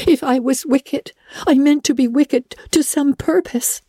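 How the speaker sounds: put-on high, earnest girl's voice; girl telling how she was deceived. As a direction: plead her case. Anne explains the peddler.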